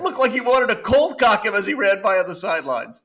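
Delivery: laughing while talking. Real, not acted. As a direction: laugh through the jab at the coach. Laughing at a sideline clip.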